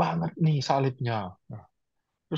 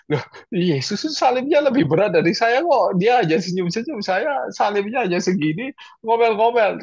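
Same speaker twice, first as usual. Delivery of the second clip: louder, higher self-scolding; startled self-reproach, raised voice; surprised realisation; 7 semitones higher, 6 dB louder than usual. Retold or imitated. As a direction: catch yourself in disbelief. Sudden realisation, scolding himself.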